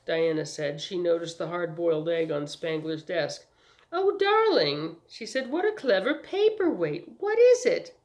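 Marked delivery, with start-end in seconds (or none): 3.9-5.0 slow, high, affected exclamation; charmed socialite; gushing delight. A narrator's reading. 5.5-7.9 high, affected wonder; charmed socialite marvelling; gushing curiosity. A narrator's reading. Socialite delighted by a trinket.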